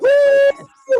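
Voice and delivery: high, loud whoop of delight; excited cheer. Real, not acted. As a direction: cheer like your team just scored. Guest shows movie tattoo.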